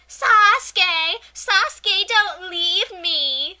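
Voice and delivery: put-on whining cheerleader girl, high and loud; Sakura. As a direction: drag out a needy, high-pitched wail. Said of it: "she sounds like a whining cheerleader girl".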